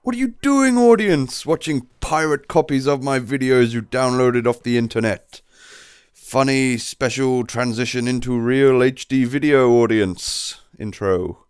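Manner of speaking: loud, high-pitched hammy video host impression; Toby Turner. YouTuber greeting his audience in a funny intro, loud hammed-up impression. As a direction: boom it out in a bad vlogger impression, pitch leaping on the first words. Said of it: "that was my pretty awful impression of Toby Turner".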